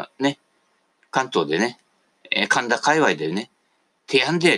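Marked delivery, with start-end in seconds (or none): 4.1-4.6 Edo dialect. Retold or imitated. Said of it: "って言ってる人はね".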